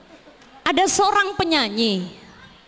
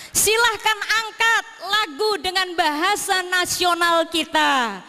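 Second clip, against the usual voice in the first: ordinary talk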